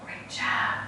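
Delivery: soft whispered great job; whispered praise. Real, not acted. Teacher quietly praising children as they move, whispered. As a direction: whisper the praise under your breath. Praising kids during movement game.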